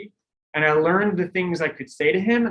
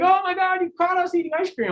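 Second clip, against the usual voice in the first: put-on high, squealing kid voice, caught out; mock kids' squeal. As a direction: play the startled teenagers, pitched way up. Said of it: "All the kids were like".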